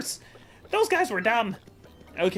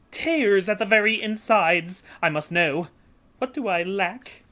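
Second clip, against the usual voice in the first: hammed-up Italian-style merchant, grand and theatrical; hammy Flavio accent; 3 semitones higher than usual. Voicing Flavio introducing himself to Mario, hammy Italian-style accent. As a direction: ham up a boastful rich trader with a put-on Italian lilt. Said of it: "need a voice".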